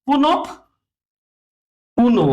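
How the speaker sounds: পুনপ then পুনো, split by a long pause; doubled consonant demo. Shown as a demonstration. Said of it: "দুইবার হয়ে যাবে".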